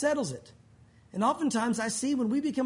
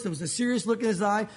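normal talking voice

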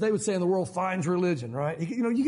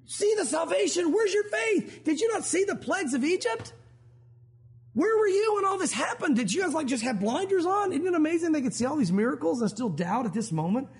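scolding Moses; 8 semitones higher than usual. Retold or imitated. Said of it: "He gets their attention".

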